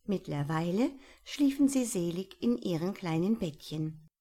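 ordinary delivery, nothing marked

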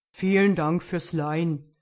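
loud storybook bear; polite bear booming out thanks. A narrator's reading.

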